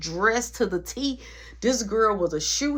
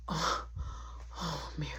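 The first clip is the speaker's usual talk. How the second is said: very soft, breath-led murmur; mock intimate moan; 5 semitones lower, 12 dB quieter than usual. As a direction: act out being overcome, barely voiced. Retold moaning during intimate moment.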